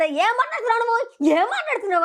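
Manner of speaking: high-pitched mock confrontation; imitated challenge. Retold housemate challenging Reetu in a quarrel, imitated challenge. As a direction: mimic a housemate demanding an explanation.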